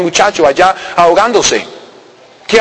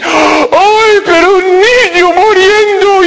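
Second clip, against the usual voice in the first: mock anguished cry; 11 semitones higher, much slower than usual. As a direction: cry out as if heartbroken. Heartbroken rescuer voiced. (grito angustiado y exagerado, en voz aguda y lenta)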